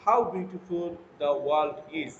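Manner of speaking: very slow, every word spaced out; slow model reading. Shown as a demonstration. Teacher reads an example sentence to the class, very slow. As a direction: read it slowly for students to copy. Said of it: "আমি যখন পড়েছি ... তারতম্য ছিল".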